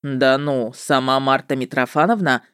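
low, mock-impressed witcher voice; drawling witcher. A narrator's reading. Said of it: "С каким-то сложным выражением протянул он".

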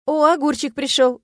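light gasp of glad surprise; girl spotting a friend at the window, surprised. A narrator's reading. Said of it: "удивилась я".

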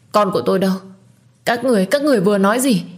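panicked plea. A narrator's reading. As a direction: beg frantically for her baby. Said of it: "hoảng loạn và gào thét, khóc thảm thiết". (van xin hoảng loạn)

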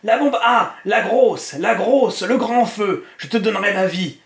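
high, loud frenzied exultation; possessed triumph. A narrator's reading. Trashcan offers the bomb to his master, triumphant frenzy. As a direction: cry it out like a man possessed. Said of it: "comme un possédé".

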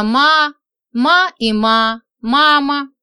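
slow mechanical ma-ma; drawn-out toy doll sound; doll voice box. A narrator's reading. Doll's voice box imitated.

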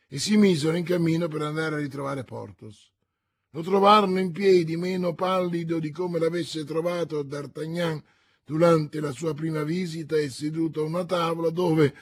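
ordinary delivery

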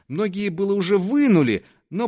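ordinary delivery, nothing marked

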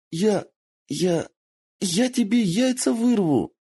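put-on high fox girl yelping a threat; squeaky threat. A narrator's reading. Kitsune pinned down, threatening in a high squeal. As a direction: blurt a silly threat in a squeal.

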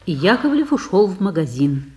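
ordinary talk, nothing marked

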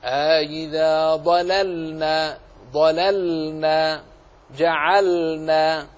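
slow, drawn-out recitation holding the L before N in "ضللنا" and "جعلنا"; clear still lam. Shown as a demonstration. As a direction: chant "ضللنا", "جعلنا" with a distinct vowelless L. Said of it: "احرص على بيان سكون اللام". (ترتيل بطيء مع إظهار سكون اللام في "ضللنا" و"جعلنا")

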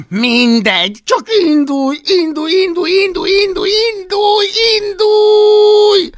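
very high, full-force repeated yell; furious yelling. A narrator's reading. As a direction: scream the order over and over. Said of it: "üvöltötte Hablaty".

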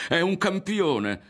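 high, slow, loud proclamation falling at the end; impassioned cry, firmly falling; about to shout. A narrator's reading. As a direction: proclaim it with fervour. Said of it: "stava per gridare".

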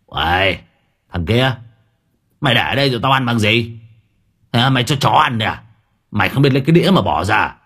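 put-on fast slurred drunk scolding; drunken slur. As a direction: bark at him like a drunk father. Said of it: "cất cái giọng lè nhè".

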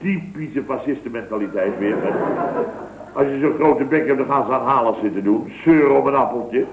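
ordinary talk

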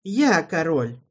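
a mild snap without much force; half-hearted snap. A narrator's reading. Alvin snaps back at Erald, without much force. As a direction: bite back weakly. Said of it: "огрызнулся Алвин, но без особого нажима".

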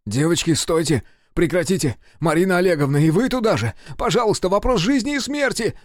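loud, high, urgent begging; pleading. A narrator's reading. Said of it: "умолял Серёжа". (отчаянно умолять)

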